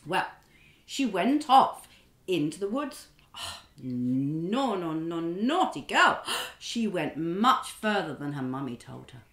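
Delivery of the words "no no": ordinary delivery, nothing marked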